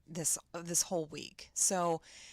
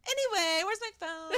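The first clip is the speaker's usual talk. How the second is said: offhand, pitched up and loud, couldn't care less; breezy mock indifference; desensitized kids; 11 semitones higher, 6 dB louder than usual. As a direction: toss it off like a bored kid. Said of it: "these children are so desensitized".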